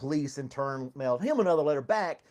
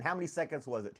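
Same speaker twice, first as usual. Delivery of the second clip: ordinary talk, nothing marked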